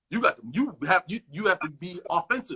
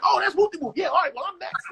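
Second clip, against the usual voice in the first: excited fan; 10 semitones higher, 5 dB louder than usual. Retold or imitated. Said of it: "This was like".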